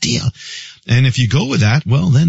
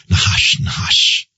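Nahash breathed out in a pure whisper, no voice; 4 semitones lower, slower than usual. Shown as a demonstration. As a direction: hiss "Nahash, Nahash" like a snake whispering. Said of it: "it sounds like somebody's whispering".

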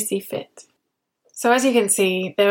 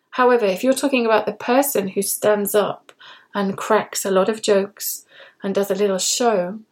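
usual speaking voice

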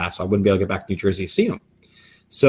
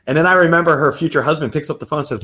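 ordinary talk, nothing marked

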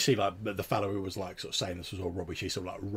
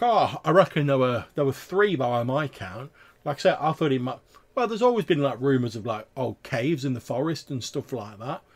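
louder rustic trapper, rambling on; chatty tavern tale-teller; 4 semitones higher, 7 dB louder than usual. Acted. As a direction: ramble like a country trapper with a yarn.

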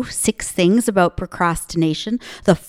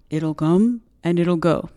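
imitating dad; 4 semitones lower than usual. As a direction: do dad's voice, a touch closer to how he said it. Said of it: "That's more like how he would say it".

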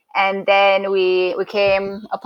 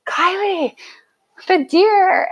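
high, drawn call to a friend; retold calling out; 12 semitones higher, slower than usual. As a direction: call her over in a high voice.

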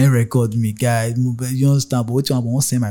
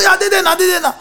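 high conductor voice; 5 dB louder than usual. Retold or imitated. Conductor answering.

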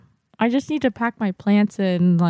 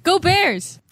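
cheering; 10 semitones higher than usual. Real, not acted. Cheering for the team.

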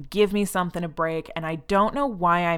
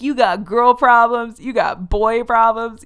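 higher, slow, loud mock rock-star preaching; 4 semitones higher, 7 dB louder, slower than usual. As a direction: ham up a frontman lecturing the crowd, drawn out. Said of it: "in the middle, he's like".

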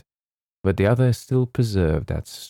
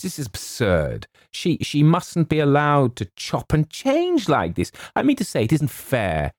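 higher-pitched, mildly acted outrage; aggrieved young man's protest; indignant protest; 9 semitones higher than usual. A narrator's reading. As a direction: object as if cheated. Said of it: "cried James".

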